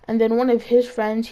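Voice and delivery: ordinary talk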